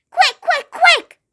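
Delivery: high, urgent giant's cry. A narrator's reading. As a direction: hurry her on, pitch up. Giant urging hurry.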